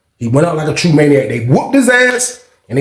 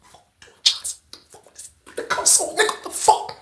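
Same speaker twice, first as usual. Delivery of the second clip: whispered cursing; frantic whisper from behind the door; hushed exasperation; 13 semitones higher, 11 dB quieter than usual. Retold or imitated. Hissed plea from behind the door.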